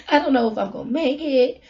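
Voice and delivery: ordinary delivery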